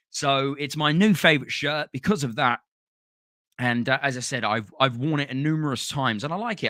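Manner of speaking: normal talking voice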